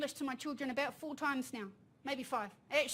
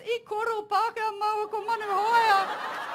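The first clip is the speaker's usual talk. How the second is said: put-on higher, louder child voice through laughter; young son confessing; 7 semitones higher, 8 dB louder than usual. Young son confessing he spoke English with Manu, higher and laughing. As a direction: play the boy blurting out his crime. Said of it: "He goes".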